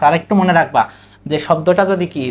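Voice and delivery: usual speaking voice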